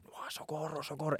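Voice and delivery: high-pitched, hushed mock plea for help; panicked help call kept inside the head; silent cry for help. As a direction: cry socorro high but barely voiced, as if trapped in a dream. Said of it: "y yo, claro, gritando".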